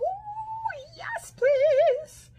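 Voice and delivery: high, thrilled squeal of delight; excited squeal. Real, not acted. Vlogger squealing at her viewers over a hat.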